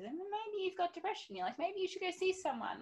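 lightly voiced caring mother; gentle mum voice. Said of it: "she said".

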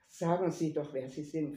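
ordinary delivery, nothing marked

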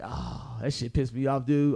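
low, soft grumble to himself; fed up and under his breath; a quiet defeated mutter. Retold or imitated. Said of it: "I was like".

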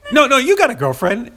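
high, loud, overriding "no, no"; don't let him answer, just tell him; insistent tough guy. Retold or imitated. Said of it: "it comes across like".

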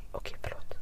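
sheepish whispered apology; whispering after the bouncer scolds. Retold or imitated. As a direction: whisper it meekly. Meek reply after guard.

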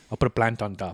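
lightly imitated deadpan dad; Wayne impression. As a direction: state the odd plan matter-of-factly. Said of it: "that's a Wayne impression".

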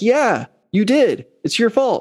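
drawn-out, high-pitched blame; accusing retort. Acted. Car owner answering whoever keyed it, accusing retort. Acted retort to mock apology.

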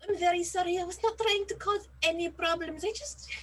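put-on character voice, well above own pitch; high, apologetic innocent caught trespassing; apologetic drummer character, pleading.

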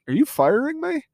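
hurt disbelief at being fired; shocked disbelief. Retold or imitated. Walt asks his dad in shock.

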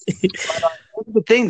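slow yawning words. Real, not acted. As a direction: stretch it out in a yawn. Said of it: "I'm a yawning person".

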